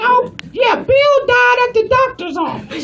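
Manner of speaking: put-on high-pitched old lady voice; high, rambling old lady on the phone; elderly woman customer. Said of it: "She's like".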